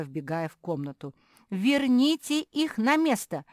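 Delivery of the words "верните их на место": high, loud, slow order; shouted demand. A narrator's reading. Зефирка to sisters throwing her things, shouting. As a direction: demand it loudly, stretching the words. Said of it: "закричала Зефирка".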